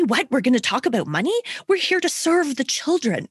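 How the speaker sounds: high, scandalized protest; mock indignant. Traditional OTs objecting to talk of money, mock-indignant. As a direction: act shocked, pitching the voice up.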